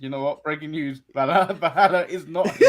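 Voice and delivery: low, mock-serious bulletin; news anchor voice. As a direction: deliver a deadpan breaking news report. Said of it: "redo the bit and be like".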